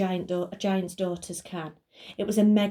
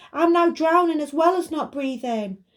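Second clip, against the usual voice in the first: desperate shout; 8 semitones higher, 5 dB louder than usual. Acted. Buster shouting from underneath.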